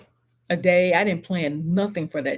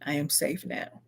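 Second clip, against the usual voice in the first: quiet affirmation; 4 semitones lower, 10 dB quieter than usual. Shown as a demonstration. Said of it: "tell yourself".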